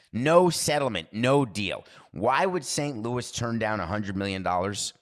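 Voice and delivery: normal talking voice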